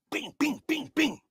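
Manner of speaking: quick, high-pitched bings; rapid imitated punch noises; punch sound effects. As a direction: fire off bings like jabs.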